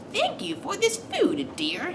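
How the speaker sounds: very high, slow, soft fake granny voice; wolf's falsetto grandma disguise. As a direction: fake a sweet old granny, high and drawn out. Wolf posing as grandma.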